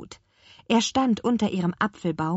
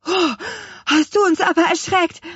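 gasp, then high, loud exclamation; shocked children, shrieky gasp and shout; startled cry; 9 dB louder than usual. A narrator's reading. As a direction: cry out as if just given a fright. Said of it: "riefen die Zwillinge".

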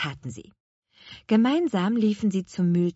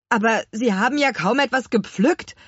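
raised, louder voice of protest; complaining; 5 semitones higher, 5 dB louder than usual. A narrator's reading. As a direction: object indignantly. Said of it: "beschwerte sich Herr Kuchenbrand". (empörte Beschwerde)

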